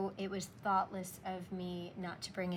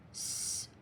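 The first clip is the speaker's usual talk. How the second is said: whispered model word. Shown as a demonstration. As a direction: whisper salt to the class. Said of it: "Here's how it goes".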